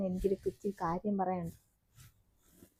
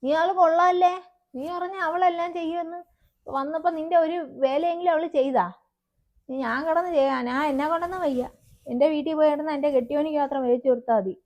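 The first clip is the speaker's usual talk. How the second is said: angry scolding; 6 semitones higher, 4 dB louder than usual. Acted. Mother complains, leaving in a huff.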